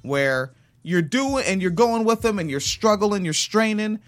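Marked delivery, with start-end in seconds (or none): none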